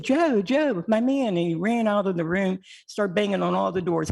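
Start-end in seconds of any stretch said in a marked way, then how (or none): 0.0-1.4 retold joyful cry, pitched up high; excited greeting. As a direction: greet an old friend's name with delight. Otis greeting her brother's name joyfully.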